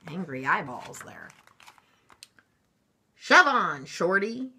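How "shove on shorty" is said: pushy shout, loud at the start; yelling bus. A narrator's reading. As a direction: yell it like a bossy bus. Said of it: "yelled a double-decker bus".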